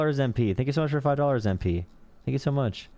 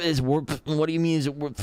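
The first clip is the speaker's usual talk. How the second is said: high, stumbling confused voice; flustered mock confusion; 6 semitones higher, faster than usual. Mocking the conservative in the meme, high confused sputter. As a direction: sputter like you can't follow the question. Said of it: "the Patrick Wallet meme".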